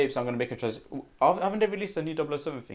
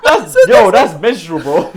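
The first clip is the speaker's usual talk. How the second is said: laughing; 4 semitones higher, 15 dB louder, slower than usual. Real, not acted. Laughing joke.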